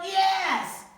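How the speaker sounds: very loud, high yell. Acted. Cashier yelling at the father, loud yell. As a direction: yell it back. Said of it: "The man yelled".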